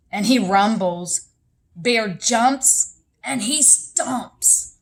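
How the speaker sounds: slow, loud, menacing narration; growling storyteller. A narrator's reading. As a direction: act out the angry bear's rumbling in the telling. Narrating angry bear's growling.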